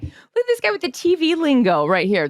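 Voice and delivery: teasing. Retold or imitated. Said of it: "I was like".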